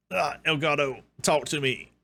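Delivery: ordinary delivery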